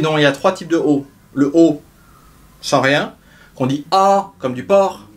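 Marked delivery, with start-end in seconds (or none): none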